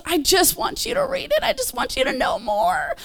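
a high, soft, tender voice of God; voicing God gently. Preacher voicing God's invitation, high and soft. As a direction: coax them like a loving father. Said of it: "and he goes".

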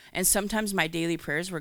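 ordinary delivery

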